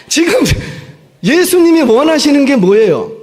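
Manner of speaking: loud, high, exasperated question; frustrated. Real, not acted. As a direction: demand an answer, fed up. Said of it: "아, 나 답답하네".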